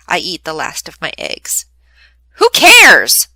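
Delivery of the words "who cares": loud, high dismissive shout. Acted. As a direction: blurt it out across the table. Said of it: "Sonny shouts".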